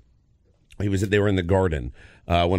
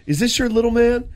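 put-on high, loud, hearty greeting to a kid; imitating a warm hearty hello; friendly big guy; 12 semitones higher, 4 dB louder than usual. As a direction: beam down at a little boy. Trevor Hoffman greeting the son.

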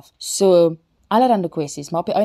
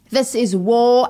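louder, higher proclamation; emphatic declaration; 7 semitones higher, 7 dB louder, slower than usual. Acted. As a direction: land it like a battle cry. Climax line of poem.

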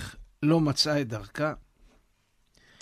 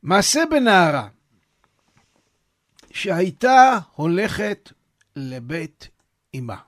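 reading the source text aloud; 3 semitones higher, 7 dB louder than usual. Acted. Reads the source story aloud.

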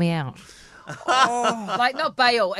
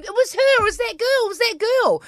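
high-pitched, insistent blurting; retold frantic accusation; 12 semitones higher, faster than usual. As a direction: blurt it high, pointing the blame. Waking dazed, accusing her to police.

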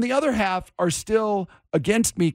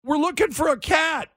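high-pitched shouted reply; kid calling out; 11 semitones higher than usual. Retold or imitated. Kids explain themselves to the homeowner, calling out high. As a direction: imitate a kid yelling back. Kid yells back at host.

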